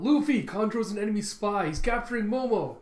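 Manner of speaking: high, loud alarmed warning; Chopper freaking out. Retold or imitated. Crewmate warning captain about the spy, high panicked shout. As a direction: imitate a panicked crewmate calling out. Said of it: "Chopper freaking out as he calls out".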